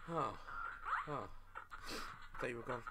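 relieved whisper. Real, not acted. Relieved soft.

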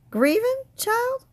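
a sharp high scoffing yelp; scoffing echo. A narrator's reading. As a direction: toss the word back as if absurd.

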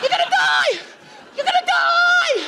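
high, slow shouted warning; mock shout. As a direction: yell it up at the truck.

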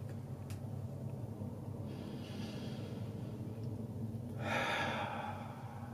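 long, slow breath in and out; big meditative breath let out as a sigh; deep breath. Shown as a demonstration. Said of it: "Let's take another big breath".

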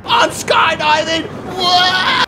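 drawn-out high cry of delight; thrilled shout. Real, not acted. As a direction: shout it out in wonder. Second take, higher and slower.

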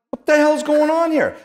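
put-on high, loud indignant teen; retold disbelief. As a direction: protest like a kid whose glove was just grabbed. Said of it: "And I'm like".

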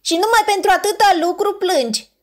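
high, amused question ending in a laugh; high penguin voice with a little laugh. Acted. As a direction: chuckle at how small the problem is.

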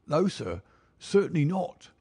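high, protesting servant voice; frightened servant. A narrator's reading. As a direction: deny it as a servant afraid of being found out. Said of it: "Bannister's face was ghastly".

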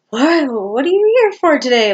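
high, loud startled question; surprised receptionist. Retold or imitated. Receptionist shocked to see the patient in a wheelchair, high loud surprise. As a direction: play the receptionist caught off guard.

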